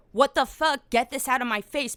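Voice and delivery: indignant quoted outburst; outraged reaction. Retold or imitated. Voicing own disgusted outraged reaction.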